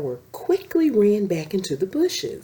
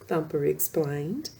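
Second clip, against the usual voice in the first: ordinary delivery, nothing marked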